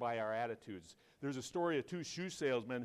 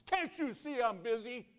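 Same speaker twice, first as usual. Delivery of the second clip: high, loud retold outburst; irritated yell; 13 semitones higher, 8 dB louder than usual. Busy man to the visiting salesman, annoyed yell.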